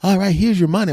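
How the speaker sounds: mock club owner. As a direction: imitate the dude handing over cash. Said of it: "dude is like".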